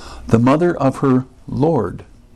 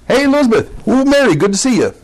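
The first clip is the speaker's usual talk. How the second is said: mock breezy hello, pitched high; high-pitched offhand hello; casual greeting; 10 semitones higher, faster than usual. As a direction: chirp a quick, casual greeting between relatives. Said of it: "which is not just".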